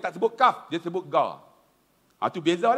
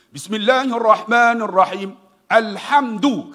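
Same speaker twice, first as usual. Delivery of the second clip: quran recitation; 3 semitones higher, 7 dB louder, slower than usual. Shown as a demonstration.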